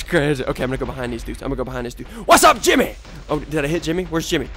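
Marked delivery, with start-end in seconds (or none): 2.3-3.0 high, raised-voice greeting; taunting shout. Real, not acted. Gamer surprising Jimmy, taunting shout. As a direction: call out gleefully to your target.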